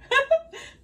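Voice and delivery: short amused laugh at herself; high laugh. Real, not acted. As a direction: laugh at your own accent. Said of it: "My country accent be popping out".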